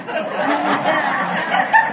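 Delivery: a short, high, nervous laugh; awkward high laugh. Acted. Preacher acting out his awkward reaction with a high, nervous laugh.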